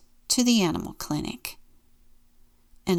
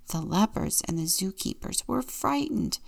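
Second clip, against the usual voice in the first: ordinary delivery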